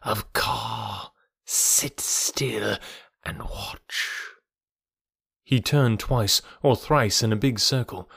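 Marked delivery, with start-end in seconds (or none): 1.4-4.1 slow, hushed snake voice; hypnotic python. A narrator's reading. Kaa ordering onlookers to sit still, hypnotic hush. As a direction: command softly as a great snake.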